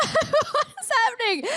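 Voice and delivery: high, laughing bewilderment; retold disbelief. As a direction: reenact being baffled, through a laugh. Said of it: "And I'm like".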